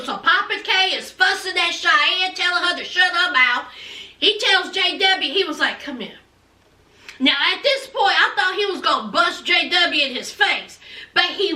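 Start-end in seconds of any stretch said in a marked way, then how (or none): 5.8-6.2 very low, soft order; hushed summons. Retold or imitated. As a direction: beckon someone close under the voice. Father calls son over to whisper.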